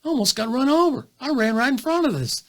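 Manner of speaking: high, animated squirrel recounting a close call; squirrel telling pals. Acted. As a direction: act out a squirrel amazed at its near miss. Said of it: "He's going".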